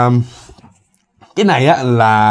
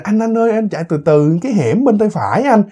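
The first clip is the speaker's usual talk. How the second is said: higher, coaxing directions to the driver; a hint of a young woman's voice, pitched up; lightly voiced girl passenger; 6 semitones higher than usual. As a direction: lightly play a girl guiding him. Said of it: "nhỏ này nó mới vỗ vỗ vai ổng".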